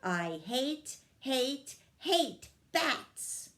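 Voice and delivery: little fairy stamping out her hatred, rising to a peak; building tantrum. A narrator's reading. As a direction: wind up into a shrill childish outburst.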